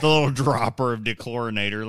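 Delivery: ordinary talk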